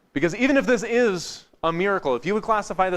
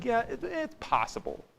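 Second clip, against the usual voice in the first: high, soft, hesitant admission; reluctant, pitched up then falling away; grudging concession; 8 semitones higher, 7 dB quieter than usual. Shown as a demonstration. Grudgingly conceding a second explanation.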